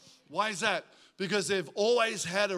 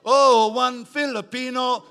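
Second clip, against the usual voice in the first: much louder, slow, high thrilled cry; delighted exclamation; 6 semitones higher, 12 dB louder, slower than usual. Retold or imitated. Pastor reliving his joy at a Filipino caller, loud and slow. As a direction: savour it like a prize catch.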